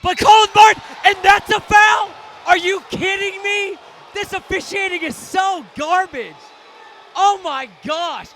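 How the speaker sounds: loud, high outraged yelling at the refs; furious shout. Real, not acted. Commentator yelling at the refs over a foul call, furious shout. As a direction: blast the officials, fully furious.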